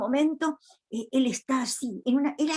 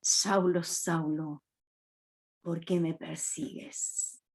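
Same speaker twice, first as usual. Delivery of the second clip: low, hushed, slow heavenly voice calling out; voice of Jesus; 5 semitones lower, 6 dB quieter, slower than usual. Retold or imitated. Voice from heaven calling Saulo on the road, low and hushed. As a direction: let the voice from heaven drop low and grave. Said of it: "que le dice".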